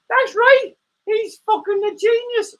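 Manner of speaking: high defiant meerkat voice; cheeky puppet voice. Acted. Puppet meerkat mouthing off to its owner, high and defiant.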